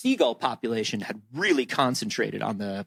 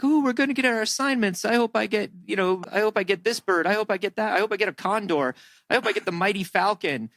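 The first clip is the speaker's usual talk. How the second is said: giddy, higher-voiced classroom anticipation; eager students; 7 semitones higher than usual. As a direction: act out excited kids wishing for the best pick. Kids eagerly awaiting assignments.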